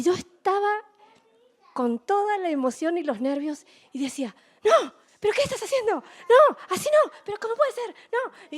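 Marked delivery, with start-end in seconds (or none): 4.6-8.6 high, fast, loud outburst at the screen; mock desperate football mother; frantic fan yelling. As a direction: plead with the players, fast and high.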